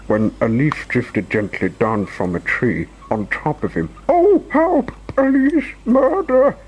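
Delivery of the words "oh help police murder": very high, loud cries for help; terrified scream. A narrator's reading. Mister Jelly feels a leaf land on him, screaming. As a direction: scream each cry in squeaky panic. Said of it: "he screamed".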